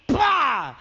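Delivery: loud explosive vocal thwack; imitated slap "pah". Comic imitates slap across the face, loud "pah". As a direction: burst out a slap noise.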